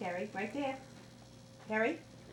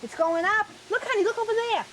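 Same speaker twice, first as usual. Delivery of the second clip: high, fast, excited pointing; 10 semitones higher, 4 dB louder, faster than usual. Real, not acted. Excited pointing out bubbles to toddler.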